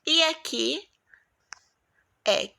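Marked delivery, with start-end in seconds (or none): none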